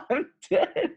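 words stretched slowly through helpless high laughter; can barely get it out for laughing. Real, not acted. Friend cracks up at the one handful joke, laughing.

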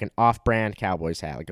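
ordinary delivery

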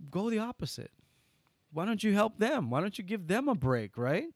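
ordinary delivery

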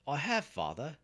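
high, loud eager reply; proud son speaking up; eager retort. A narrator's reading. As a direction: answer your father eagerly, voice lifted. Son eagerly answers father's refusal.

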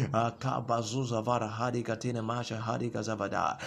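rapid prayer syllables in tongues; speaking in tongues. Acted. Pastor praying in tongues over the hearers. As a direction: let the tongues flow.

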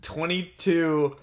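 slow, giggling through the words; reading while cracking up; laughing. Real, not acted. Reads title, breaks into laughter.

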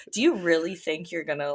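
ordinary delivery

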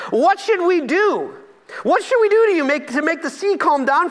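begging for a way out; frightened crew pleading; panicked sailors. Acted. Sailors beg Jonah in storm.

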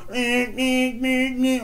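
high, whiny mock meh meh; mock whiny bleating. Mocking the kidnapped kid who wakes him up, whiny bleating.

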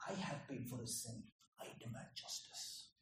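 soft, almost whispered certainty; quiet confidence. Retold or imitated. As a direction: state it calmly, hushed and sure. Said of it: "standing there in confidence".

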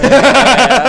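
big hearty laugh; loud burst of laughter. Real, not acted.

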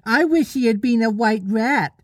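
put-on high, sing-song little boy; childish wishful voice; lost boy interrupting. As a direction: pitch it up as a child butting in.

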